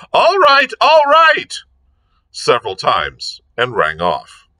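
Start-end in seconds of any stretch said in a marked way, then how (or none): 0.1-1.5 much higher and louder, shrieked down the phone; screamed. A narrator's reading. Lord Peter screaming reassurance into the telephone, high shout. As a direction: scream it so a deaf woman hears. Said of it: "he screamed".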